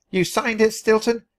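high, pushy command; demanding. A narrator's reading. Penelope pressuring Stilton to sing, demanding. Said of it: "she demanded".

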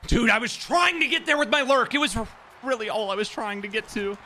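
very high, strained complaint; exasperated protest. Real, not acted. Gamer explaining his failed lurk, high-pitched exasperation. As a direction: protest in a high voice, easing in volume.

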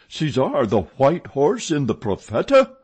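high, loud disbelieving question; cried out. A narrator's reading. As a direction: cry out in disbelief. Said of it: "cried the acting manager".

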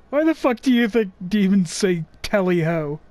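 ordinary talk

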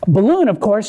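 ordinary talk

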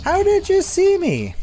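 high disbelieving shout; shocked outcry. Real, not acted. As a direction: yell it in disbelief. Caught by the monster.

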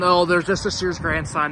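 ordinary talk, nothing marked

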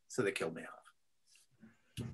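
low, soft whisper, slow; whispered punchline. Real, not acted. Telling the interviewer he was written off, hushed whisper.

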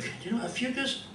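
usual speaking voice

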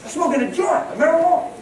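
amused incredulity; laughing disbelief. Real, not acted. Incredulous retelling, laughing loud.

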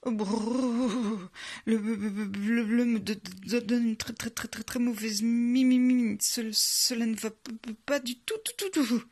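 freezing, shivering stutter; shuddering with cold, syllables repeated; teeth chattering. A narrator's reading. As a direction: stammer through chattering teeth. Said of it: "En claquant les dents".

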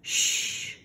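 whispered shush; hushing. Acted. Akeo hushing Handa near the mice, whispered. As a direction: hush the others softly. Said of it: "said Akeo".

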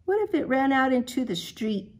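normal talking voice

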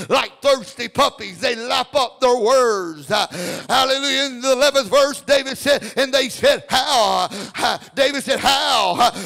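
loud, rising preacher's cry; full-force preaching. Acted. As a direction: preach it out to the congregation.

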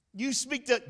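high, loud, astonished questioner; amazed fan. Retold or imitated. Fan quizzing the speaker about his fame, high and amazed. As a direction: play the starstruck fan.

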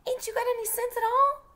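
high exasperated holler. Acted. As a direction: yell it in disbelief. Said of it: "Jem hollered".

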